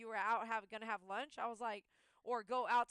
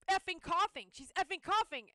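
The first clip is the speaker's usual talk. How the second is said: alarmed; 7 semitones higher, 9 dB louder than usual. Retold or imitated. Retold alarm at dog coughing.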